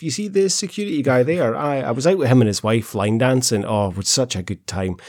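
chatty Glaswegian airport worker; lightly voiced Glasgow lady gossiping; broad Scottish accent. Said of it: "she's like".

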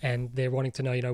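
ordinary delivery

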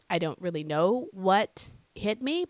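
ordinary delivery

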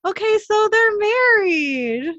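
real excited laughter; delighted. Real, not acted. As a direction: squeal it out in delight. Delighted at the twist.

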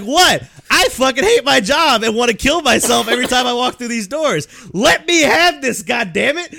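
fed-up cashier ranting at customers, hammed-up yell; mock rant. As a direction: rant like you've had it with everything.

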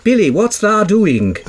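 laughing mock Yorkshire dialect; Yorkshire accent. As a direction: read it in a broad Yorkshire voice. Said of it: "Imagine someone doing an Audible of me Oops book".

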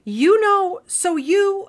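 high, loud, excited teenager imitation. Teenager finds her teacher shares her holiday, excited. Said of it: "She was all excited".

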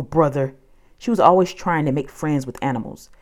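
ordinary delivery, nothing marked

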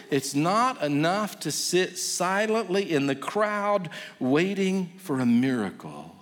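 normal talking voice

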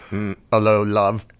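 tentative Cockney-style British greeting; lightly put-on British voice; mock British accent. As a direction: try a bad British accent, half-heartedly. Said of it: "I can't do a British accent".

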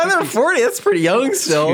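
high mock voice of a thirty-something shrugging at forty; mocking young-sounding voice. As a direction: squeak it out like someone brushing forty off as young. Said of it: "We go".

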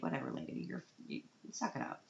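put-on whispered, bored kid brush-off; dismissive whisper. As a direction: shrug it off in a whisper. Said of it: "he's totally like".

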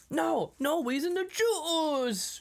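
high, slow, stretched juice; mock shopkeeper. Shopkeeper refusing Stoney, high and drawn out. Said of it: "he's like".

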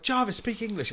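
high heckle at the stage; heckling shout. Retold or imitated. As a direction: yell it at the singer. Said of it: "I was like".

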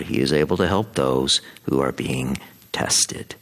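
scripture reading. Acted. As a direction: read it as a proclamation at Mass.

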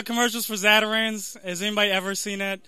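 ordinary talk

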